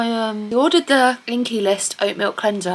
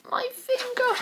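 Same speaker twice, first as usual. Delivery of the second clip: high, soft pained whine; small high-pitched whimper over a sore spot; 8 dB quieter than usual. Real, not acted. Vlogger nursing a paper cut, whimpering. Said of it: "I will stop crying about it in a minute".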